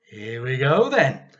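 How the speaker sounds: loud, eager call; boy springs into action; cried out. Acted. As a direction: leap up and call it out. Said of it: "cried George".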